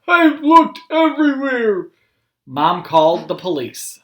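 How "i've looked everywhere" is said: put-on high, loud tearful old man; tearful Grandpa. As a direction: say it nearly crying. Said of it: "with tears in his eyes".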